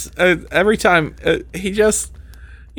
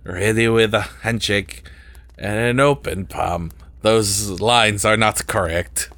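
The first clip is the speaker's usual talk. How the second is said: put-on super French accent; 3 semitones lower, 3 dB quieter than usual. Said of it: "like, a super French".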